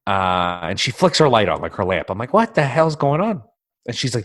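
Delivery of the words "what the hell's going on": high, slow, confused demand; startled out of sleep, asking what's happening; groggy alarm. Retold or imitated. Woken at night, asking his partner what's wrong.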